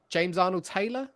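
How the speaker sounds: ordinary talk, nothing marked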